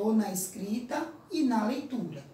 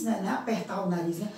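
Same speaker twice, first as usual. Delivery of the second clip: ordinary talk, nothing marked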